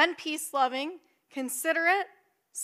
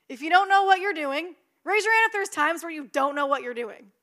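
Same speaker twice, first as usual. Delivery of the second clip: ordinary talk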